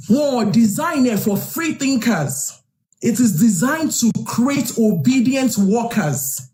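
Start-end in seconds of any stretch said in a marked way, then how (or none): none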